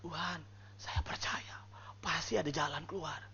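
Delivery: soft, low declaration of trust; hushed, measured prayer; whispered prayer of faith. Shown as a demonstration. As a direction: murmur it privately to God, with conviction.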